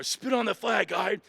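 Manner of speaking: high, loud drill instructor shout; barked order. Retold or imitated. Instructors pushing recruit to the flag, shouted order. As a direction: yell it as a retold command.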